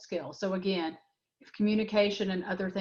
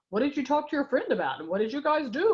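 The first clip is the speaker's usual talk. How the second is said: higher, louder nosy questioning; prying mom question; 6 semitones higher, 3 dB louder than usual. Retold or imitated. Mom grilling her son about his friend, higher and louder. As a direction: pepper the kid with direct questions. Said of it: "I don't say".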